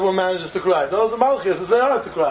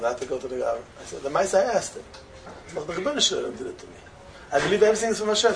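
ordinary delivery

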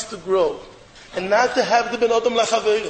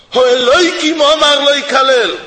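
louder proclaimed verse; forceful quote; 4 semitones higher, 7 dB louder than usual. Acted. Rabbi quoting David's reply, forceful loud proclamation.

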